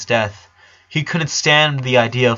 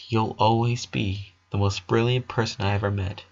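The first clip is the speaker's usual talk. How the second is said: softer, gentle reassurance; tender comfort; 5 dB quieter than usual. A narrator's reading. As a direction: soothe your little sister. Brother comforting sister.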